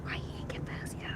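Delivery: hushed whisper. Real, not acted. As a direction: whisper it under breath. Unvoiced whispered aside.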